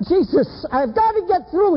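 high, urgent preacher's acting of desperation; desperate plea. Man throws himself on his knees, desperate plea. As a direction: strain to get the prayer through.

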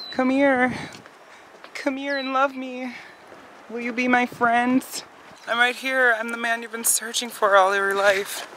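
high, hammed-up lover calling the birds; mock suitor voice. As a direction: play a pushy suitor wooing a bird, pitched high. Coaxing the birds to be friends.

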